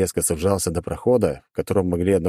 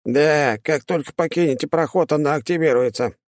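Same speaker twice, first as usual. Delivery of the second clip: high demon voice; 9 semitones higher than usual. Acted. Demon answers.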